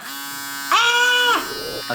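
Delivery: long, very high squeal; sustained sky-high "ah". Real, not acted. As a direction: squeal as the gadget switches on. Squeal on turning shaver on.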